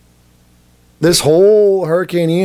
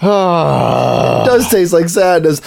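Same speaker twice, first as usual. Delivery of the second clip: laughing; much slower than usual. Real, not acted. Laughing fit after spit.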